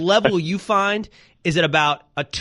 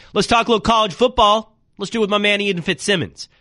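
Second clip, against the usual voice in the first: hyped segment intro; 7 semitones higher than usual. Real, not acted. Host hypes the college football segment.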